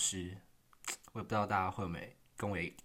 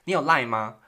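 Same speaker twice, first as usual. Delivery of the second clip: eager question; 5 semitones higher, 12 dB louder than usual. Retold or imitated. Retold classmate's eager question.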